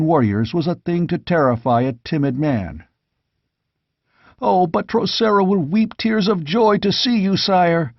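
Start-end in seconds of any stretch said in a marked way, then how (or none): 4.4-7.9 much higher, ringing with joy; joyful cry. A narrator's reading. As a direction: call it out overjoyed to the king. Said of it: "cried one".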